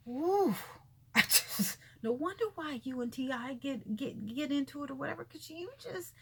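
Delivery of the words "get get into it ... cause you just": ordinary talk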